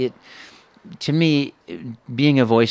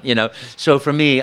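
ordinary delivery

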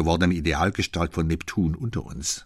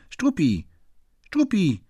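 high, louder call of the name; calling the dog; 12 semitones higher, 3 dB louder than usual. Acted. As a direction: call the dog from across the room. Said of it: "rief Fräulein Otterlo". (laut und hoch nach dem Hund rufen)

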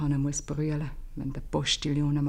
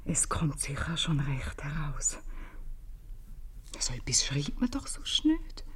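soft unvoiced whisper, thinking aloud; whispered to herself; 2 semitones lower, 4 dB quieter than usual. Worried mother alone, searching for her daughter's note, whispering. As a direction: whisper as if no one may hear.

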